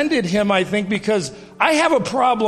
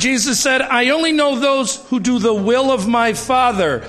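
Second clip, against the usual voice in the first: higher, more forceful sermon voice; rising preaching; 4 semitones higher than usual. Acted. Pastor urging the congregation about their equal callings, in a rising preaching voice. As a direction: drive the point home to the congregation.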